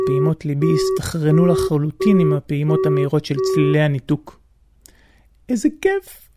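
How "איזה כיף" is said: high joyful squeal; excited. Real, not acted. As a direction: burst out with joy. Joy after hanging up.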